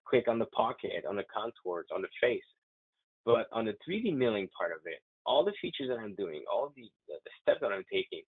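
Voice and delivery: usual speaking voice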